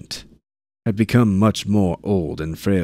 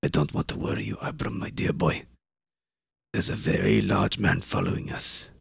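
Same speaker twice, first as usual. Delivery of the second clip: whispering; 5 dB quieter than usual. Acted. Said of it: "whispered up into Gaunt's ear".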